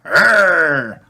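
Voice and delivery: put-on loud high toddler growl; a tiny boy pretending to roar. Toddler answering as his dinosaur, loud high growl. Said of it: "replies George".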